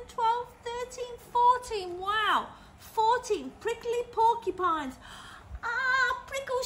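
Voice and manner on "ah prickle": high, sudden yelp of pain; a balloon crying out as a porcupine pricks it, high yelp; shouted. A narrator's reading. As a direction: yelp as the spike pricks him. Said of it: "shouted Gordon".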